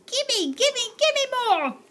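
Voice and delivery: very high greedy chant; greedy demand. Acted. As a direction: grab at it with your voice.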